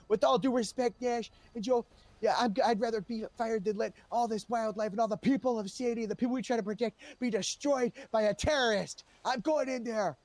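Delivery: a cop refusing orders to stop; heroic defiance. Retold or imitated. A cop tells his bosses he's going into the water, loud and defiant. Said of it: "I said".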